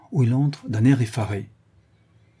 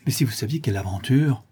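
ordinary delivery, nothing marked

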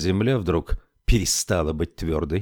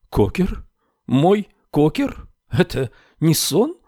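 stunned hope; 8 semitones higher than usual. A narrator's reading. Widow hears dead husband near.

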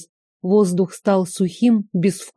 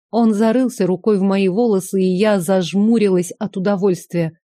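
ordinary delivery

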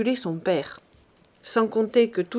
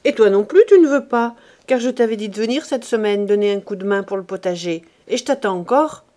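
much louder, rising anger; angry scolding; 7 dB louder than usual. Acted. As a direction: Lazare tears into the lazy boy. Lazare scolds the boy.

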